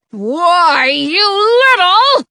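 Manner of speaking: building, high angry shout. A narrator's reading. The caged king rages at Rumpelstiltskin, starting to shout. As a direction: sputter up into a loud threat. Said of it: "the king begins to shout".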